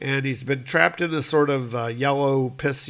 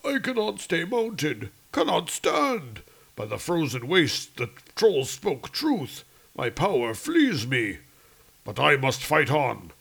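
Odin's voice; 2 semitones higher than usual. Acted. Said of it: "And he's like".

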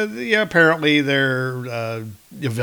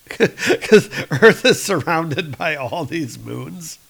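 normal talking voice

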